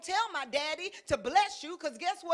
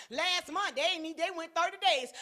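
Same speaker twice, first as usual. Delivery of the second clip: ordinary delivery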